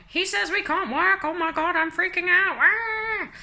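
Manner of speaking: high-pitched mock freak-out; hammed-up panic. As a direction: wail it in comic panic, ending in a cry. Frantic message to Evie, acted.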